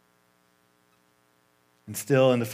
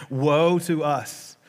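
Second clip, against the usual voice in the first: drawn-out wail; 4 dB louder, much slower than usual. Acted. Philistines lament in scripture reading.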